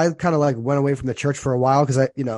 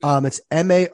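ordinary talk

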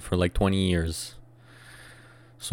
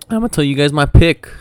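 voice cracking; 7 semitones higher, 8 dB louder, faster than usual. Real, not acted. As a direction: voice cracks up high. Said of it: "I think my voice just cracked".

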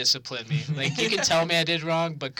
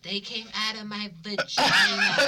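higher, slow, laughing mom voice; drunk mom boast; 6 semitones higher than usual. Retold or imitated. Son imitating drunk mom bragging to friends, high and giggly. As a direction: ham up the tipsy mother's proud boast. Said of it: "she's like".

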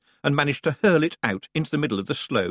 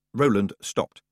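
plain reading voice

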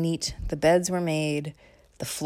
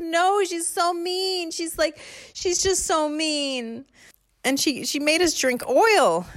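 put-on high, slow whining child; high slow complaint; whiny kid; 13 semitones higher, slower than usual. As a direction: whine to mom about grandma.

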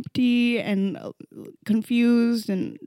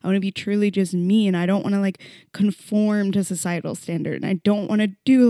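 usual speaking voice

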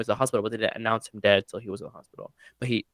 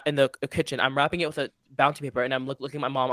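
ordinary talk

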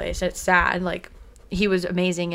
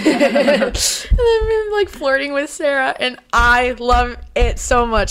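high, laughing fangirl squeal; laughing gush; 6 semitones higher, 6 dB louder, slower than usual. Real, not acted. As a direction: laugh while gushing over him. Fangirling laugh about Bucky.

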